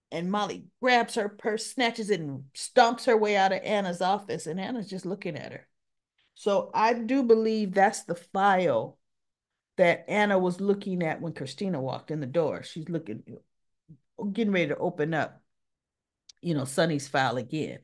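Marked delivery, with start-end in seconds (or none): none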